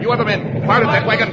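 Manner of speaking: high, loud order; rally the guards to shoot; commanding shout. Acted. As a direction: Holmes barking an order to fire. Holmes orders men to fire.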